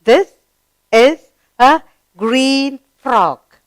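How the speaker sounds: slow, with a high lifted first word; deliberate classroom reading; read-along model. Shown as a demonstration. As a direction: stretch each word for learners to follow. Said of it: "read the sentence".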